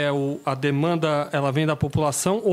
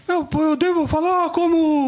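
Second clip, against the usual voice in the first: high nasal voice with a slushy lisp; lisping nasal Bolsonaro; 9 semitones higher, 3 dB louder, slower than usual. Retold or imitated. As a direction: mimic a lisping, nasal politician. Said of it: "um Bolsonaro com a língua presa e fanho".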